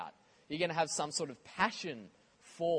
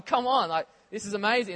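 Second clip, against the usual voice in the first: higher and louder, thrilled; excited; 4 semitones higher, 6 dB louder than usual. Retold or imitated. Pastor on his own notes, excited exclamation. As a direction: burst out with it. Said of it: "something I'm going".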